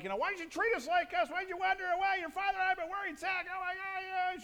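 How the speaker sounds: high, loud scolding mother voice; hammed-up shrill mother yelling at her son; Mary yelling. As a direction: scold like a frantic mom. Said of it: "And Mary is yelling at him".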